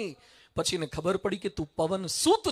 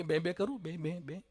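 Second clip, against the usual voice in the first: meek bleating lamb; 5 semitones lower, 11 dB quieter than usual. Acted. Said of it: "એ કે".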